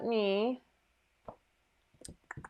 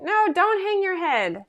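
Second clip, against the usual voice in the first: high, loud and slow, No drawn out; emphatic reassurance; 9 semitones higher, 6 dB louder, slower than usual. Real, not acted. To Colleen who pointed out her goof, emphatic high reassurance. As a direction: insist kindly that she needn't feel bad.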